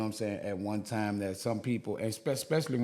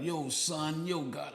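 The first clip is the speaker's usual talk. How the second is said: put-on New York accent; 5 semitones higher, slower than usual. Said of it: "people was kinda joking".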